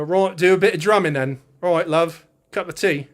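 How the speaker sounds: loud, hammed-up British accent; generic English accent. Shown as a demonstration. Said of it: "English accents".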